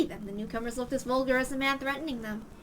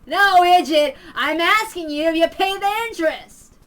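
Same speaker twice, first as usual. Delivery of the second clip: very loud, higher insult and question; furious yell; 6 semitones higher, 14 dB louder than usual. Acted. Man screaming at Ritsuko about the interest, very loud. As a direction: scream it in her face. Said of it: "yelled at each other".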